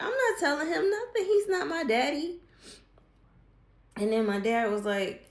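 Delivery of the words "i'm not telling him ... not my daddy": much higher, louder defiant retort; defiant refusal. Retold or imitated. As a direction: snap back like a defiant teen. She refuses dad's demand.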